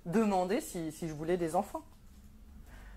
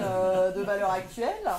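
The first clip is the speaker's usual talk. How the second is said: ordinary delivery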